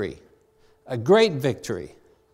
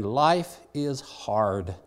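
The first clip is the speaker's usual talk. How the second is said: slow modern paraphrase; 6 semitones lower, 4 dB quieter, much slower than usual. Shown as a demonstration. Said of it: "I can translate that to you in modern language by saying".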